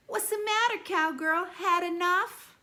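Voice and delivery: put-on bully taunting the new kid on the bus, jeering. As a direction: sneer at the new kid to make him squeal. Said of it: "hissing in Roy's right ear".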